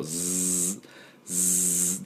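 "ズー" as a long soft buzz; voiced z held long on "ズー"; bee-buzz z. Shown as a demonstration. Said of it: "蜂が飛ぶ音".

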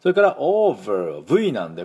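ordinary talk, nothing marked

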